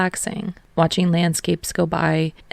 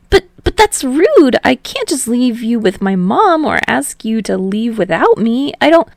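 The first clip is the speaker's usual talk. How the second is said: higher, stammering and flustered; flustered young man voice; 6 semitones higher, 6 dB louder than usual. A narrator's reading. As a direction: protest in a fluster, tripping. Narrator voicing Hinata.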